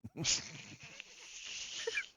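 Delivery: high, soft, breathless laugh. Real, not acted. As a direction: laugh quietly after your own joke. Laughs at own dry joke.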